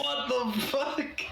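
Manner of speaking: high, slow stunned question; high slow baffled swear from Callahan; shocked. Acted. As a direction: drag it out in disbelief. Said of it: "He's like".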